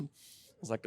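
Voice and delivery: usual speaking voice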